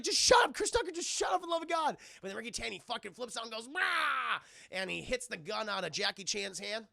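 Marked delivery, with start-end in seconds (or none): 0.0-2.0 high, loud, increasingly rushed yell; mock exasperated shout. Jackie Chan silencing Chris Tucker, exasperated mock shout. Acted quarrel. 3.7-4.4 high drawn-out "rah" yell; mock battle cry. Ricky Tan flipping out, mock battle cry.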